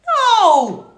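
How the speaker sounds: high, loud shocked cry of no; horrified shout. Real, not acted. Shocked outburst at comment.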